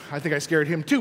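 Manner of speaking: usual speaking voice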